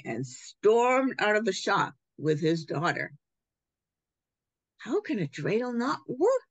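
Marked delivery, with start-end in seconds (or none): 4.8-6.4 a higher puzzled wonder; puzzled shopkeeper. Acted. Shopkeeper alone, puzzling over the dreidel, high and wondering. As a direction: ask it of the empty shop. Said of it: "the shopkeeper said to himself".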